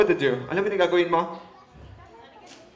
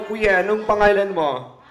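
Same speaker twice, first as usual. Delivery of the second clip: ordinary talk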